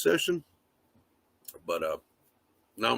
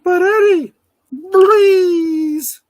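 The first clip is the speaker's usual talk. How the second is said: much higher and louder, pitched-up filter voice; Snapchat filter voice; 10 dB louder, much slower than usual. Pretend. Vlogger testing a Snapchat filter on camera, pitched-up voice. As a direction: talk through a chipmunk-style voice filter. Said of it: "these Snapchat filters are, you know, pretty wild".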